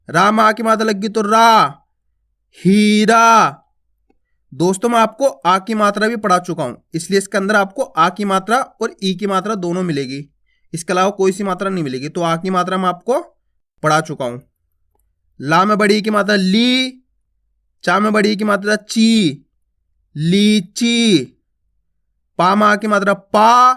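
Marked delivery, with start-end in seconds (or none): 2.6-3.6 Heera sounded out long and slow; drawn out. Shown as a demonstration. Teacher drawing the word out for children. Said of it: "खींच खींच के उच्चारण करना है". 20.2-21.2 लीची held long on each sound; drawn out. Shown as a demonstration. Teacher drawing the word out for children. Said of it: "खींच खींच के उच्चारण करना है".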